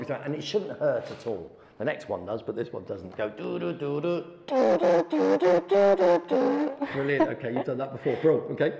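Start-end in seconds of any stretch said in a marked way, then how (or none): 3.3-6.9 buzzy "do"s climbing much higher and louder; rising buzzing doos. Shown as a demonstration. Teacher leading the kazoo exercise, climbing to a loud high buzz. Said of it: "you make the same noise as that".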